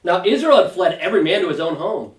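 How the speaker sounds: measured, solemn Bible reading; scripture reading. Acted. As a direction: read the chapter aloud to the class, unhurried. Reads scripture passage aloud.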